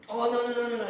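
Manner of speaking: high, loud string of quick denials; urgent protest. Acted. Psychologist heading off a patient's refusal, urgent. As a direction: rush to head off the objection. Hastily reassuring reluctant Matthew.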